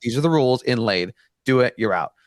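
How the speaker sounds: ordinary talk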